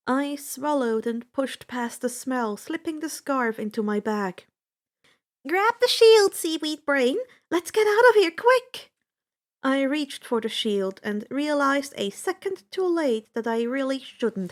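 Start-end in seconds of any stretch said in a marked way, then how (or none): 5.5-8.9 high, loud hurried order; urgent shout. A narrator's reading. Annabeth shouting at Percy to grab the shield. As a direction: hurry him along, urgent.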